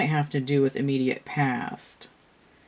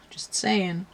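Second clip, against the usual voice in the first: hushed sly whisper; whispered aside; 4 semitones higher, 3 dB quieter than usual. Real, not acted. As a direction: let it slip as a secret. Sly aside about the cards.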